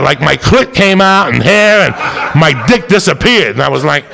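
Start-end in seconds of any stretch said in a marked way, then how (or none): none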